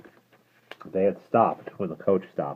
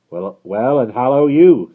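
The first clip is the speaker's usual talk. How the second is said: more hoarsely; 7 semitones higher, 14 dB louder, slower than usual. Acted. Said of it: "said Jerry, more hoarsely than before".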